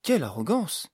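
indignant character exclamation; indignant outcry. A narrator's reading. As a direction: exclaim it, scandalized. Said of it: "s'écria Amélise".

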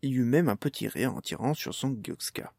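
ordinary delivery, nothing marked

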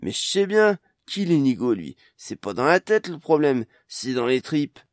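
woman protesting fear is in her gut, agitated. Acted. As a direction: push back, nerves showing. She protests her fear again.

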